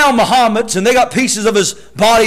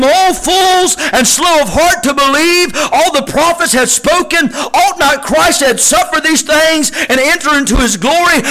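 full-force preaching; 5 semitones higher than usual. Acted. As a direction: drive it home to the church. Scripture preached loud.

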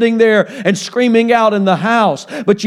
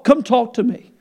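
normal talking voice

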